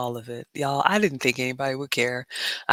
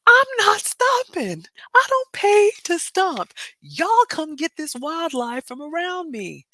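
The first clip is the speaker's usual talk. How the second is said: very high, loud, slow indignant cry; outraged refusal; 13 semitones higher, 6 dB louder than usual. Retold or imitated. Telling chat about the airport rat, high indignant shriek. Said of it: "reliving rat at the gate".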